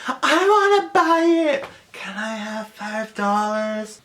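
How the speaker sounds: put-on high, then slow pleading child voice; kiddie whine. A little girl begging for five dollars, high and slow. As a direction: beg like a small kid. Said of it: "that whole kiddie thing".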